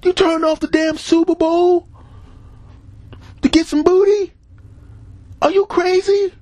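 mock furious squeal down the phone; angry friends. Friends calling him, outraged and high-pitched. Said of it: "they got mad and called me".

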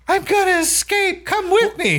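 very high put-on girly voice; Daisy squeaky. Daisy urging Zach to escape, very high squeaky voice. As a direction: squeak it out like a giddy influencer. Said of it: "She's like".

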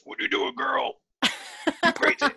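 high, strained wrestler trash talk; WWE voice. Retold or imitated. Co-host mocking Taylor's villain self-talk, over-the-top WWE voice. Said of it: "Taylor's W W E voice".

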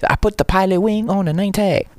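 high-pitched imitation Chinese accent. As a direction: mimic a coworker's thick Chinese accent. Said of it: "Chinese accent".

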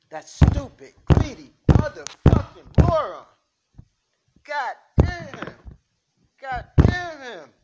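loud, slow furious shouting; furious rant. Acted. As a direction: explode at the idiot who got them caught. Learns crew busted over drugs.

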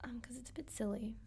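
soft unvoiced whisper. Real, not acted. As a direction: breathe it out in a whisper. Whispered joke reply.

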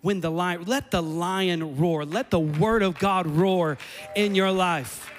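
slow, loud, building roar of a call; rising preaching climax. Acted. Pastor urging the church to let the word roar, slow and loud.

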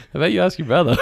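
laughing deflection. Retold or imitated. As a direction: pass the question off through giggles. Joking deflection retold amid laughter.

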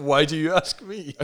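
loud, high, overacted question; mock the line with a big swoop upwards; hammy mimicry. Co-host re-enacting Mark's opposite reply, hammy and loud.

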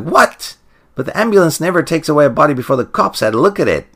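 high, fast disbelieving outburst; incredulous. Retold or imitated. Luke protesting the missing body, incredulous. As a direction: blurt it out in shocked disbelief. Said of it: "And Luke's like".

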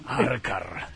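very low, mock Russian growl; Russian villain voice. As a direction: drop deep like a Russian villain. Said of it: "de ruso". (voz muy grave de villano ruso)

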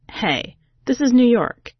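plain reading voice

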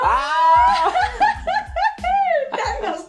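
long yelled cry breaking into laughter; death cry. Pretend. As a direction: cry out the name as if dying, laughing. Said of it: "sería como grito de muerte".

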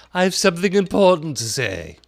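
high, loud caterpillar voice. A narrator's reading. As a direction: announce it grandly.

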